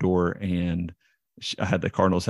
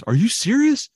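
high, loud, incredulous question; stunned dad; 6 dB louder than usual. Retold or imitated. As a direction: blurt it out in disbelief. Dad's stunned reaction to signing news.

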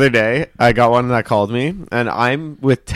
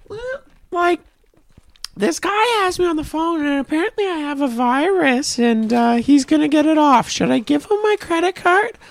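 much higher, fretful mother voice; worried mom. Retold or imitated. Mom on the phone asking her son about the scammer, high fretful voice. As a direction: squeak it out like an anxious mom.

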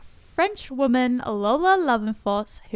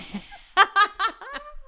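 high laughing burst; embarrassed laugh; 11 semitones higher, 3 dB louder than usual. Real, not acted. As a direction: laugh at the mispronunciation. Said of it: "Wow, Australian there".

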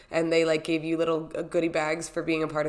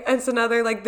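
usual speaking voice

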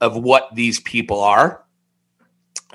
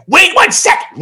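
high, loud mock yell; imitating aggressive lady; 4 dB louder than usual. Lady at the restaurant to the delivery worker, mock aggressive yell. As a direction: snap it out high and loud as her. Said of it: "This is exactly how she sounded".

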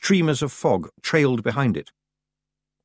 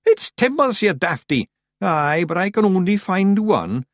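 high, loud squabbling; clamouring voices; 8 semitones higher, 4 dB louder than usual. A narrator's reading. Rowdy rescuers squabbling over a sailor's oath, clamouring. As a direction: bicker noisily in high character voices. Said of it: "there was the usual clamour".

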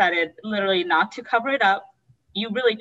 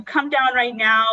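retold yell; 5 semitones higher, 5 dB louder, slower than usual. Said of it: "started screaming at him".

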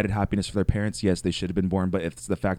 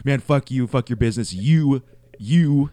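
loud, higher, furious swearing; cussing out; 6 semitones higher, 6 dB louder than usual. Retold or imitated. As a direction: spit the curses down the phone. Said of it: "proceeds to cuss me out".

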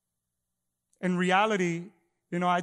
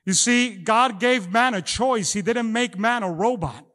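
preaching; 3 semitones higher, 4 dB louder than usual. Acted. Pastor preaching to his congregation, rising fervor. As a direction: drive the point home from the pulpit.

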